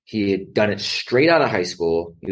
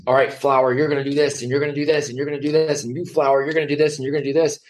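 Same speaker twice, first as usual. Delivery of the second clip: higher, bossy orders to a flower; lightly mocking bossy system voice; bossy schoolmaster; 5 semitones higher, faster than usual. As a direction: command the flower like a drill list. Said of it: "conveyor belt school says".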